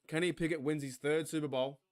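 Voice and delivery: ordinary talk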